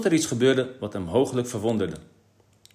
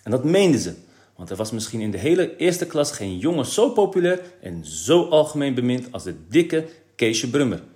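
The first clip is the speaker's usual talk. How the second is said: plain reading voice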